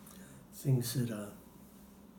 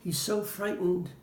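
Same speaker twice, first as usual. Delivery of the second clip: higher, anxious mother voice; a touch of a fretful mom; quoting the worried mom; 7 semitones higher than usual. Retold or imitated. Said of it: "and she goes".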